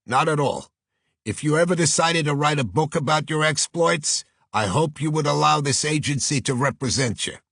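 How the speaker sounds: high-pitched Brooklyn agent making a pitch; Brooklyn accent. Acted. New York agent wooing a prospective client, strong Brooklyn accent. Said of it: "a voice with a strong Brooklyn accent".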